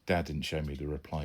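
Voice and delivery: ordinary delivery, nothing marked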